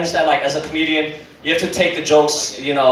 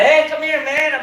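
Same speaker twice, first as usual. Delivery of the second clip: high, friendly hey; friendly call; 10 semitones higher than usual. Retold or imitated. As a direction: call him over like a buddy, pitched up.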